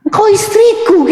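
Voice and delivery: high-pitched indignant comeback; acted husband objecting; husband's retort. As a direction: protest up high to the wife. Husband retorts to comparing wife.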